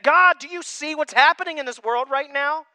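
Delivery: high, loud desperate questioning of God; a believer crying out to God, loud and pleading; pleading prayer. Retold or imitated. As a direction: beg God to look at the pain. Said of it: "even I have said it".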